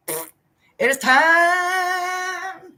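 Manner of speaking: very slow, high, sung-out declaration; high drawn-out it is time; drawn out dramatic. Real, not acted. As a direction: stretch it like a grand proclamation.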